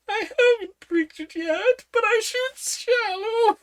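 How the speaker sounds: flustered queen, high and loud; very high queen voice, slow. Acted. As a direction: squeal it out slowly.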